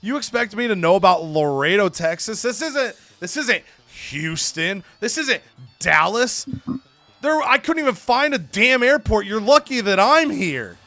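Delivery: indignant promo. Acted. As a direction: play an affronted heel ranting at the crowd. Irate wrestler promo, quoted.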